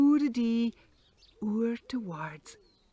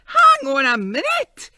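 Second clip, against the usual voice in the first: loud protest; 2 semitones higher, 14 dB louder than usual. Acted. Devil protests the boulders.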